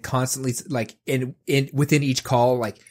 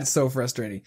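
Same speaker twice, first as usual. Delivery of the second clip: usual speaking voice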